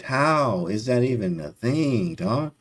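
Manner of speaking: ordinary talk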